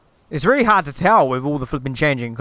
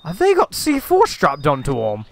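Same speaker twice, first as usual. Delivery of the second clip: ordinary talk, nothing marked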